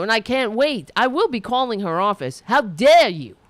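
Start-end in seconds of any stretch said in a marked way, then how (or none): 2.5-3.4 louder indignant demand; real anger, louder; real angry outburst. Real, not acted. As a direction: throw it at her, outraged. Ranting at Nancy Pelosi.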